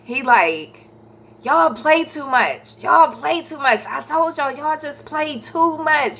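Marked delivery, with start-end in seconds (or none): 1.4-6.2 mildly exasperated telling-off in imitation; imitating upset husband. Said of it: "He upset".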